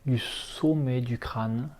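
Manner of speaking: usual speaking voice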